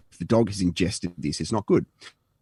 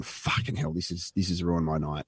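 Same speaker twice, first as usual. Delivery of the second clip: softer, under-the-breath grumble; muttered curse; 4 semitones lower, 8 dB quieter than usual. Retold or imitated. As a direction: mutter it to yourself. Grumbling alone in the Uber.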